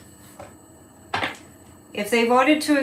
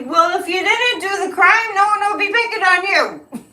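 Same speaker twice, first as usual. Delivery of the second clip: raised, pointed comeback; sarcastic retort; 8 semitones higher, 4 dB louder than usual. Real, not acted. Host answering Trump, pointed and raised. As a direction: fire back at the whiner.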